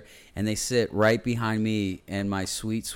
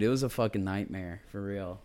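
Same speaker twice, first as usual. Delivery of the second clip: usual speaking voice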